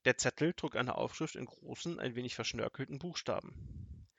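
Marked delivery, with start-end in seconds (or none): none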